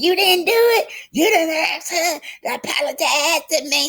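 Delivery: high-pitched acted yelling. A narrator's reading. Tisha accusing her friend of not asking, yelling high. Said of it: "She just kept yelling over there".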